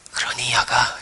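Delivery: put-on soft whispered tenderness to a child; tender whisper. God whispering comfort to a frightened child. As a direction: whisper gently like a loving father. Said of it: "이렇게 그러실 것 같아요".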